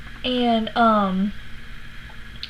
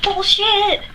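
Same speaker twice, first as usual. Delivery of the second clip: high, loud retold scoff; incredulous outburst, playfully acted; exclaimed disbelief; 11 semitones higher, 5 dB louder than usual. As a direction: call it out, voice shooting up. Retold disbelief at his excuse.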